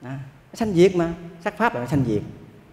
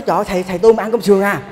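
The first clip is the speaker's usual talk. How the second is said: retold cry of disbelief, louder and higher; shocked outcry; 4 semitones higher, 5 dB louder than usual. Food seller scandalized at a monk's order, mock outcry. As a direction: imitate her scandalized exclamation. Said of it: "Nó la lên, nó nói".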